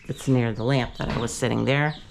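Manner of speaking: ordinary delivery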